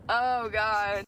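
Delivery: ordinary talk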